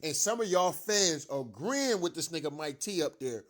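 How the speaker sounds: ordinary talk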